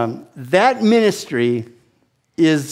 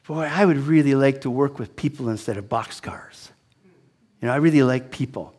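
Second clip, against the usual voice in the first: whispered thought; 4 semitones lower, 5 dB quieter than usual. Retold or imitated. Private thought, hushed.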